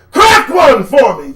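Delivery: loud mocking yell, slowed; loud jeering demand; taunting shout. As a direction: bark it at them.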